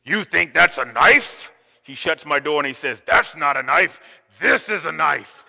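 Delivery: mock-menacing stranger; gruff voice. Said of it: "in a gruff voice".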